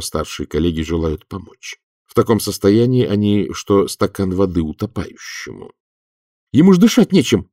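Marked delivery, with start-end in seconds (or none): 6.1-7.5 higher, louder protest; outraged objection; indignant. A narrator's reading. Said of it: "возмутился проницай".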